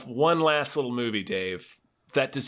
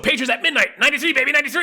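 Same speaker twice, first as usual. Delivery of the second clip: hyped; 10 semitones higher, 6 dB louder, much faster than usual. Pretend. Hype chant.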